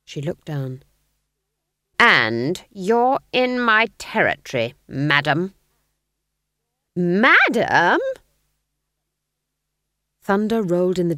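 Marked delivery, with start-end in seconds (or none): 2.0-5.5 loud, slow territorial warning; haughty challenge. Acted. Nanny warns Granny off her territory, haughtily. Witches' quarrel over territory. 7.0-8.0 loud, indignant rising question; affronted echo. Acted. Granny echoes Nanny's insult, affronted.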